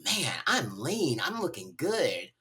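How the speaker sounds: mock-smug "Man, I'm lean"; smug self-admiration. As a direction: admire yourself in the mirror, a bit cocky. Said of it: "I'm like".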